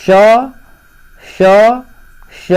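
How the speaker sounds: श said alone each time with long pauses between; sounding the letters. Shown as a demonstration. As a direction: give each श on its own like a teacher listing sounds. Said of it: "उष्म व्यंजन क्या होता है".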